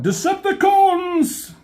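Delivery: high, loud rallying yell; rally the troops at the top of your voice; battle cry. Retold or imitated. Megatron calling his troops to attack, loud battle cry.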